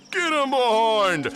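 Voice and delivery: high, loud drawn-out holler; yell. A narrator's reading. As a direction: call it out high and long, falling at the end. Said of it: "hear them say".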